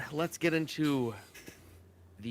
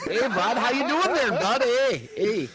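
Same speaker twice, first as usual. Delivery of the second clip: loud, high hammed-up Canadian "hey bud" through a laugh; Canadian accent, loud and laughing; mock Canadian; 9 semitones higher, 7 dB louder than usual. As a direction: shout it like a cartoon Canuck. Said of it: "Canadians and Bostonians, bud".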